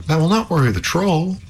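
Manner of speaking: high, worried princess. Acted. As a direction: warn him as an anxious princess. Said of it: "said the princess".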